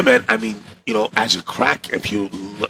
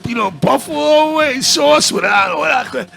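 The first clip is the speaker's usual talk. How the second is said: high, slow, half-shouted order; frantic order; 14 semitones higher than usual. Retold or imitated. Hiding from the cop, ordering at Arby's in a high rush. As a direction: blurt the order while panicking.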